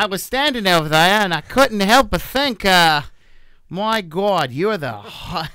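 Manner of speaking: put-on Australian accent. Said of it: "go Australian".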